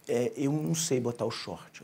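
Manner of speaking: put-on slow, soft and low, hesitant little boy; slow-talking child. Shy nephew admitting a problem to his uncle, slow and quiet. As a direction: drag it out quietly like a slow-speaking kid. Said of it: "ele fala muito lento".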